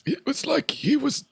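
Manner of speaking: high, slow dying-man impression; mock an old man on his last breaths; dying old Dumbledore. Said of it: "like he was dying with every breath".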